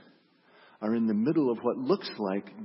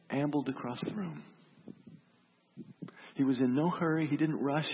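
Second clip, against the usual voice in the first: normal talking voice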